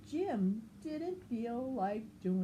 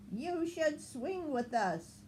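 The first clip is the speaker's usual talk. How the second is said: high, playful monkey voice; monkeys inviting; 8 semitones higher than usual. Acted. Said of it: "said the monkeys".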